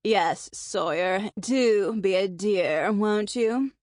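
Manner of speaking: slow, teasing British imitation; mock-posh British, mimicking the diva. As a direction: draw it out in an affected British accent. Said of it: "talk like she's British and sixty".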